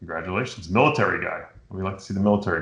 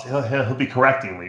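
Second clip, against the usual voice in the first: ordinary talk, nothing marked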